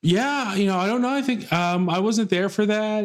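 high, evasive hesitant reply; evasive nurse. Retold or imitated. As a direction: dodge the question nervously. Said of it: "you just have to be like".

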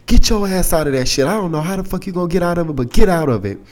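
high-pitched retold scolding, no shouting; imitated snapping. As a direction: mimic a friend urgently telling someone off. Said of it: "she started snapping".

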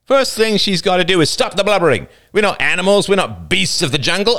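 put-on older boy sneering at the frightened girls. A narrator's reading. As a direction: sneer at her for playing dumb. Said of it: "The older boy sneered".

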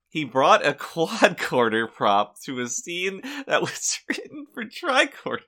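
high, breaking into laughter; laughing while talking. Real, not acted. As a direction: giggle through the punchline. Laughing through the story.

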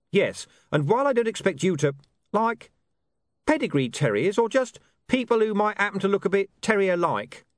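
eager reporter explaining; earnest young man. Acted. William voiced.

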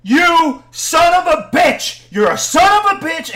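loud, high angry shout; re-enacted enraged yelling, stretched out; furious outburst. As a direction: hurl the insult at full voice. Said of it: "And I'm like, ... I stopped thinking".

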